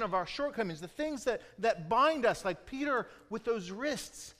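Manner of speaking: rising, loud, ringing proclamation of freedom; preaching at full force. Real, not acted. Preacher to congregation, proclaiming release from chains, loud and high.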